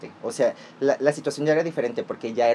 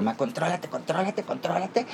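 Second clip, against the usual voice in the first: softer and higher, pressing rising repeats; hushed urging; 4 semitones higher, 6 dB quieter than usual. Retold or imitated. Urging mom to hold it together, softly and higher. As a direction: hush mom to keep calm. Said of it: "Y yo".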